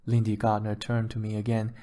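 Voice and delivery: usual speaking voice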